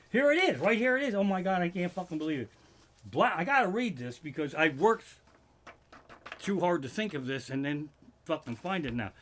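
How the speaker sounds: ordinary talk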